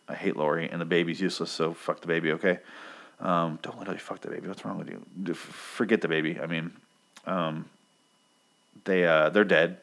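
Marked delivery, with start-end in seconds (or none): none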